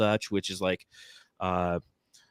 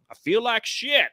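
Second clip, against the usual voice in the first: high, worn-out cashier voice; sick cashier; 12 semitones higher, slower than usual. Retold or imitated. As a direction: mimic a miserable sick cashier, pitched up. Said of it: "She goes".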